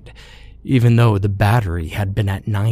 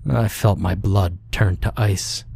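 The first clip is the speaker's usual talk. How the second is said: ordinary delivery, nothing marked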